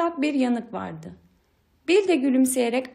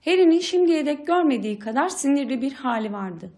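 usual speaking voice

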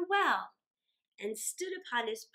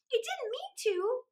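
put-on high, small child's voice; a young girl excusing herself to her father; little girl; 10 semitones higher than usual. A narrator's reading. Mary, a child, answers her father high and small.

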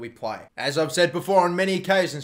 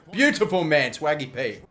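ordinary talk

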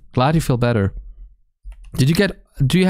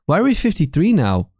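ordinary talk, nothing marked

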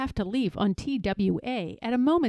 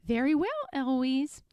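higher echo with rising end; mimicking the tutor; 4 semitones higher, 3 dB quieter than usual. A narrator's reading. Eloise standing up like her tutor, cheeky echo. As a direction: parrot it back cheekily. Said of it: "stand up and say".

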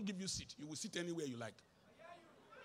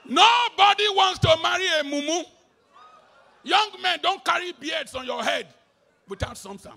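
shouted, higher scolding; loud rebuke; 5 semitones higher, 10 dB louder than usual. Real, not acted. Pastor scolding young men in the congregation, shouting.

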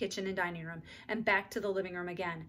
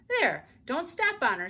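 warning; 6 semitones higher, 6 dB louder, slower than usual. A narrator's reading. Beezus warning mother.

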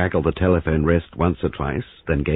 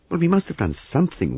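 higher, anxious wail; 13 semitones higher than usual. Acted. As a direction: wail it out in distress. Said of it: "wailed Molly".

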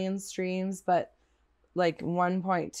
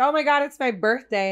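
higher and louder fake delight; excited; 6 semitones higher, 6 dB louder than usual. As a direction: gush about your birthday. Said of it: "my girlfriend would be like".

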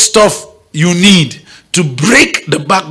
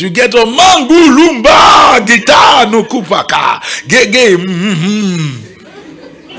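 mock tongues; 4 semitones higher, much slower than usual. Shown as a demonstration. Preacher mimicking tongues against the rent collector, shouted. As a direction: rattle off mock tongues then chuckle.

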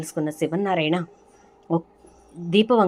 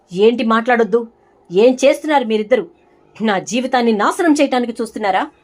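loud, higher furious protest; angry outburst; 5 semitones higher, 8 dB louder than usual. Acted. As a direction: lash out in anger at both of them. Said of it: "చాలా కోపంగా మాట్లాడుతుంది". (చాలా కోపంగా, గట్టిగా అరుస్తూ)

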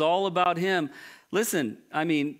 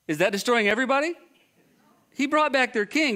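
incredulous exclaim; 7 semitones higher, 4 dB louder than usual. Real, not acted. Preacher exclaiming at Saul's excuse.

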